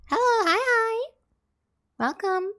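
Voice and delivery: high, squeaky hellos; high-pitched sing-song hi; squeaky greeting. Real, not acted. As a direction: chirp a high hello to chat. Greeting new viewers.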